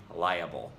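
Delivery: liable as LY-uh-bul; dialect's full pronunciation. Shown as a demonstration. Speaker shows their own dialect, three-syllable liable. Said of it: "in my dialect, we say".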